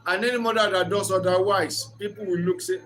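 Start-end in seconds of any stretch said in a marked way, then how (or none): none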